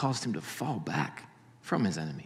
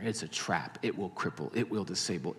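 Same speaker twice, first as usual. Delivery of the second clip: normal talking voice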